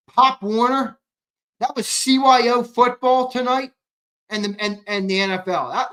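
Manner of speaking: high, loud exasperated rant; incredulous rant. Real, not acted. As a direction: rant in disbelief. Incredulous rant about energy.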